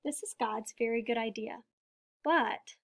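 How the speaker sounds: ordinary delivery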